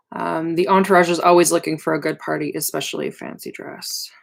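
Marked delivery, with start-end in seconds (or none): none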